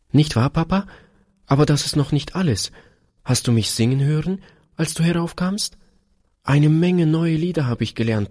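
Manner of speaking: put-on high, lilting little-girl voice; be an excited child, pitched well up; eager little daughter. A narrator's reading. Daughter offering to sing her new songs, eager high voice.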